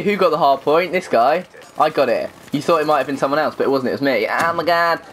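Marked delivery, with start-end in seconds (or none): none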